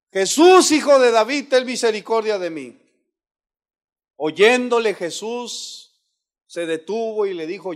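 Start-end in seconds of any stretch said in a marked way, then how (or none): 0.1-2.8 loud, high cry for mercy; full-voiced plea starting high and falling; crying out even harder. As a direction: call out desperately over the crowd. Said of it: "Clamaba mucho más".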